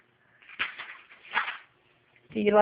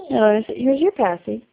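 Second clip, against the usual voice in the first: normal talking voice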